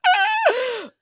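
high screeching burst of laughter; shrieking laugh. Real, not acted. Caught gossiping about sister, shrieking laugh. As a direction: break up helplessly. Howling at own slip.

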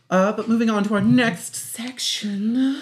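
slow, high show host announcement; announcer voice. Acted. Announcing the next segment.

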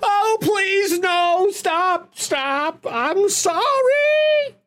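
high, pleading noble begging; begging for my life. Acted. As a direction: beg for your life in a high voice. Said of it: "starts begging for his life".

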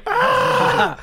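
startled yelp. Pretend. Mock shocked reply to "how dare you.

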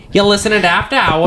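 put-on old-time radio announcer in a Mid-Atlantic accent; a 1940s newsreel reporter with a Mid-Atlantic accent. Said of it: "a reporter from the nineteen forties".

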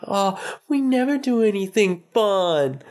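put-on high complaining child voice; whiny kid. Son complains to dad, high whiny kid voice. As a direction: sulk like a little boy.

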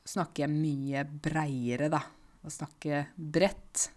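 usual speaking voice

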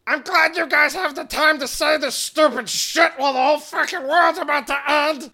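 high, loud scolding rant; hammed-up outraged tirade; angry sarcastic yelling. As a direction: shout it in furious sarcasm. Acted shouted quarrel in game.

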